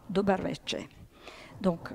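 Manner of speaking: ordinary talk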